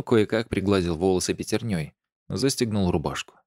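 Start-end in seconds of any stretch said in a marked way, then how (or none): none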